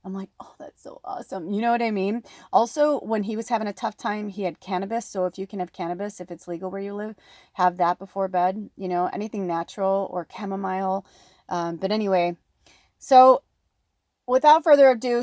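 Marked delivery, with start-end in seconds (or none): 0.4-1.5 breathy whispered delight. Retold or imitated. Whispering her happy reply to her husband. 13.0-15.2 loud, high kickoff; excited announcement. Real, not acted. Opening the reading to viewers, loud and excited.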